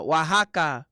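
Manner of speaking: "Oaxaca" loud, wa-HA-ka; practising "Oaxaca" as wa-ha-ka; "Oaxaca" said wah-hah-kah; repeating it. Shown as a demonstration. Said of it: "se dice".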